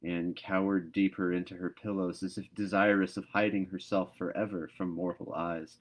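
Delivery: plain reading voice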